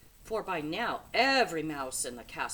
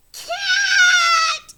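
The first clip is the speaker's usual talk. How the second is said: shrill piercing yell, much higher and louder; 21 dB louder than usual. A narrator's reading. Spinny screams a warning about the cat, a loud yell. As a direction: scream the warning at full force. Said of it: "she yelled as loud as she could".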